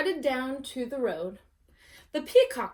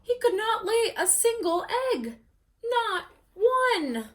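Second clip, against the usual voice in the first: higher, wailing mock dismay; 9 semitones higher, 4 dB louder than usual. A narrator's reading. Narrator mourns the peacock's lack of eggs, mock wail. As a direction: lament the failure. Sad peacock, cry heard.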